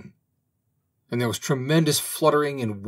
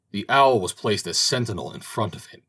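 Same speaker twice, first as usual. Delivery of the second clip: plain reading voice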